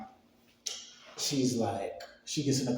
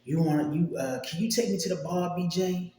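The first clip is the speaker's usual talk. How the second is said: higher-pitched mimicked girlfriend request; imitating girlfriend; 7 semitones higher, 4 dB louder than usual. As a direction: put on her higher voice, asking a favour. Retold girlfriend asking for a ride.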